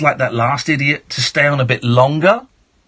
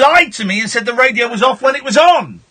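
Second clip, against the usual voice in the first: high, raised-voice telling-off; angry rant; 9 semitones higher than usual. Real, not acted. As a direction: lay into the caller indignantly. Host quarrels with banned caller.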